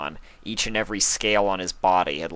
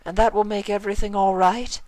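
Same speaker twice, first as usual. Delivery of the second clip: hopeful; 3 dB louder than usual. Acted. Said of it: "Rarity asked hopefully".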